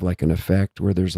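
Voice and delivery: normal talking voice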